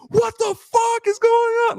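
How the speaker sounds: high, slow, incredulous yell. Retold or imitated. Fan yelling at the TV over a fumble, high slow yell. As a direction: scream it at the screen. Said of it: "I'm screaming at the T V".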